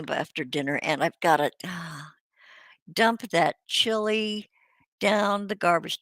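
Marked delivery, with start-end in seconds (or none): none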